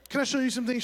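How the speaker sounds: high chirpy offer; sweetly pushy helper; eager college kid. Retold or imitated. Student offering help again.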